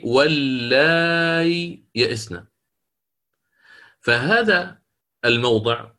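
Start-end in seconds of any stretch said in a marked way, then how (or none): 0.0-2.4 slow, drawn-out recitation of "واللائي يئسن" with the long vowel stretched; recited demo. Shown as a demonstration. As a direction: chant "واللائي" slowly, holding the madd, then "يئسن". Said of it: "صار عندي".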